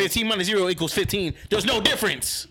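loud, high insistent yelling; shouting. Real, not acted. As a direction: yell it to win the argument. Heated math argument.

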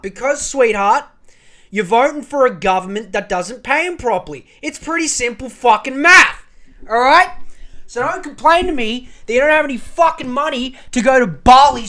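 shouting down a whinging voter; furious rant. Real, not acted. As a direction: tear into her with contempt. Ranting at a complainer.

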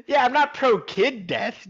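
ordinary talk, nothing marked

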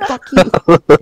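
put-on loud, high heckle at kids; mock yell. Friend pretending to heckle park kids, mock yell.

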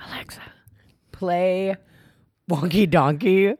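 mock toddler voice; imitating a toddler. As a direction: copy the little kid asking the speaker to play his song. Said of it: "he'll go".

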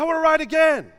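put-on high, loud whining child; a little boy begging to ride again, whining high and loud; whiny kid tantrum. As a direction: wail it like a five-year-old mid-tantrum. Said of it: "my son throws a colossal fit".

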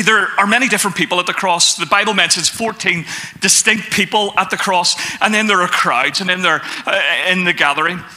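ordinary talk

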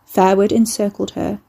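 plain reading voice

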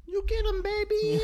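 high, loud cheering squeal; cheering girlfriend. Retold or imitated. Girlfriend cheering her man on in a bar fight, high squeal. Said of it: "his girlfriend's in the corner like".

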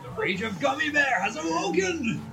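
high, slow, drawn-out proclamation; mock-epic announcer. Host heralding Gummy Bear's fury, high mock-epic announcement. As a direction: proclaim it like a monster has risen.

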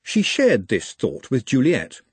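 ordinary delivery

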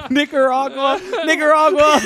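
high, loud words burst through laughter; cracking up mid-word; laughing it out. Real, not acted. Friend cracks up over the spelling game, laughing hard.

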